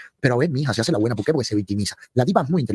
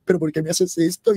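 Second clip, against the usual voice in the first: higher, slow whiny mock plea; mock whine; 6 semitones higher, slower than usual. Host imitating Jessie Ward's whining plea. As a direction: whimper it like a self-pitying victim. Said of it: "Jessie Ward salió".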